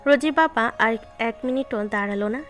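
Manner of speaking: ordinary talk, nothing marked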